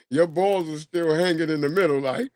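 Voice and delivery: ordinary talk, nothing marked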